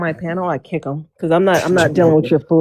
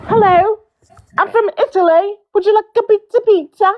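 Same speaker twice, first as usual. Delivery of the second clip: hammed-up Italian pizza seller; Italian accent; 11 semitones higher than usual. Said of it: "do your Italian one".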